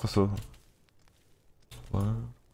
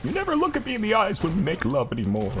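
fast, lightly higher mock complaint; mock hurt lover; 9 semitones higher, faster than usual. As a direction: play the neglected partner, half joking.

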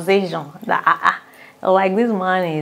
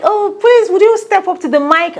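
much higher, louder showman voice; inviting host; 13 semitones higher, 8 dB louder than usual. Retold or imitated. The presenter calls the young lady to the mic: much higher, louder. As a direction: call her up to the mic like a showman.

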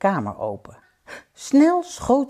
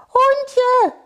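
loud call in a very high voice; 7 dB louder than usual. Acted. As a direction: shout high after a departing friend. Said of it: "riep het konijn". (luid en heel hoog roepend)

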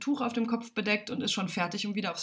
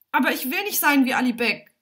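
raised, higher voice in protest; higher, louder objection; crying out; 5 semitones higher, 6 dB louder than usual. A narrator's reading. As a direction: protest loudly like an upset boy. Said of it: "schreit Alimjan".

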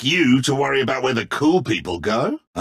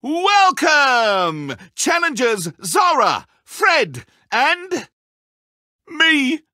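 hammed-up game show host; 7 semitones higher than usual. Villain hosts challenge intro.

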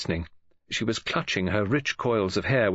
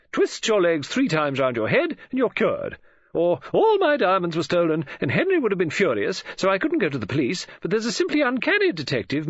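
high, louder, effusive recommendation; gushing society acquaintance; 10 semitones higher, 4 dB louder than usual. Acted. Woman mimics people recommending doctors and detectives, gushing. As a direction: gush confidingly as if sharing a marvel.

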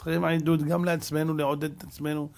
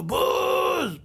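high mock boo from the stands; a high, jeering boo; booing crowd; 12 semitones higher, much slower than usual. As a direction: jeer it like a hostile away crowd. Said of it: "צועקים לו".

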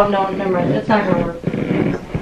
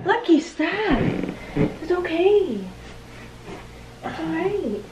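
very soft, higher coaxing voice; soft soothing; 4 semitones higher, 14 dB quieter, slower than usual. Real, not acted. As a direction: hush and reassure a pet. Soothing the dog Lucky.

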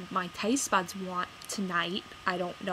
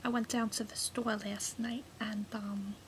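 half-whispered, much softer; hushed aside; 6 dB quieter than usual. Real, not acted. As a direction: murmur it under the breath. Quiet opening aside.

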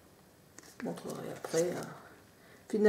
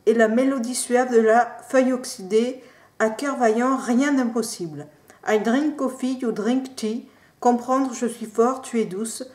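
louder, punchy promo reading; 4 semitones higher, 10 dB louder than usual. Acted. Vlogger reading the box's slogans to viewers, ad-style declaiming. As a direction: declaim the tea box blurb like an advert.

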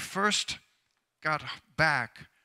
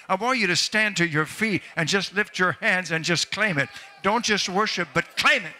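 loud, driving revival sermon, building higher to the final claim; impassioned preaching; 3 semitones higher, 7 dB louder than usual. Real, not acted. Preacher urging the congregation to claim the anointing, impassioned and building. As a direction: preach at full force, rising in pitch as it builds.

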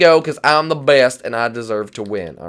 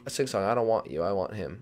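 ordinary delivery

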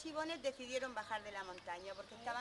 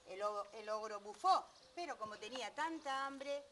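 usual speaking voice

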